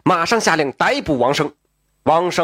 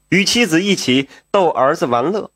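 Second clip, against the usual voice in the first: usual speaking voice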